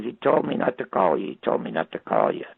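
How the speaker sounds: low, hurried imitation of a tearful excuse; retold crying. Woman explains why she didn't call, mildly imitated crying. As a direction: mimic a crying plea, dropped low and quick. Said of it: "she started to cry".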